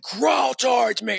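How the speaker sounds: high, slow, shouted order; commanding cop. Retold or imitated. Retelling the cop humiliating the kid on purpose. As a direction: draw it out like a cruel cop. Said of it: "And he's like".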